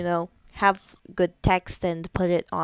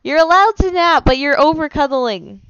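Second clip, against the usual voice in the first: exasperated; 6 semitones higher, 8 dB louder than usual. Real, not acted. Arguing with cuddling partner.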